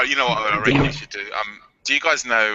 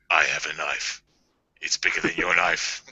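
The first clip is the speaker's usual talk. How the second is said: low, softer put-on threat; mock menacing voice; 7 semitones lower, 5 dB quieter than usual. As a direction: deliver a hammed-up knife threat, pitched down. Trying out put-on catchphrases.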